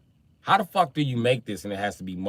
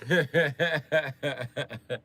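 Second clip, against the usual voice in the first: laughing; 6 semitones higher than usual. Real, not acted. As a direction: crack up at your own joke. Laughing after punchline.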